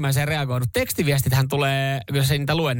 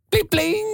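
high mock text alert pling; imitated message tone; phone ping imitation. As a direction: chime like a phone notification, pitched up. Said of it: "tekstiviestiääni".

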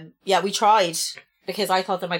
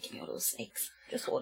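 whispered, hushed craving; whispered greed; 6 semitones lower, 14 dB quieter than usual. Retold or imitated. Telling her friend how she asks Craig for jellies, whispering. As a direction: whisper it like a secret sweet craving. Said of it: "I'm like".

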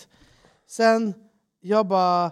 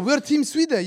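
insistent claim; 8 semitones higher than usual. Retold or imitated. Retold sales pitch.

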